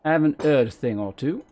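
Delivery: low-class accent, H's dropped; broad low-class accent sample. Shown as a demonstration. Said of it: "Something like that".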